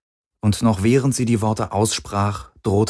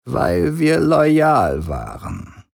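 slow, higher-pitched father's voice; 6 semitones higher, much slower than usual. A narrator's reading. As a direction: lay out the family's loyalty slowly. Vader in verhaal, trage stem.

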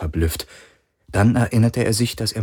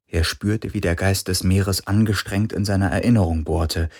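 plain reading voice

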